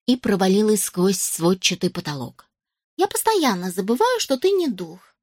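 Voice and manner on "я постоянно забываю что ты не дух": put-on elf girl voice. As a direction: say it like a sheepish elf.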